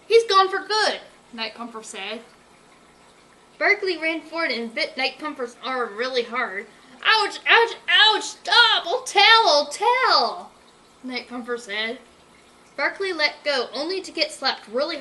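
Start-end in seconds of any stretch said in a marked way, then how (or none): 0.1-1.0 taunting. Acted. Villain taunting. 7.0-10.5 loud, high cries of pain; pained yelping. Acted. Bitten hard on the arm.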